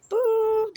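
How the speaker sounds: boop. Retold or imitated. Sound of being sent back.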